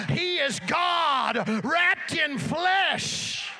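building to a shouted sermon climax; fervent, ringing pulpit proclamation; full-force preaching. Real, not acted.

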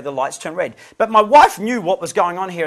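ordinary delivery

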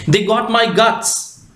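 usual speaking voice

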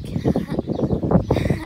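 short breathy nervous laugh. Real, not acted. Nervous before eating candy.

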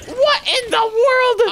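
high, slow cry of disbelief; shocked exclamation. Acted. Dash watching the explosion on Rainy's side, shocked. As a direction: draw it out in shock.

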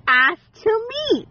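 lightly mimicking what he said; mock quote. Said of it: "and he said".

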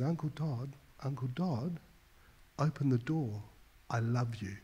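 put-on soft, gentle child's voice at the door; a hint of a small boy calling through the door; a young nephew quietly coaxing his uncle to open up; little nephew pleading. Seven-year-old nephew at the door.

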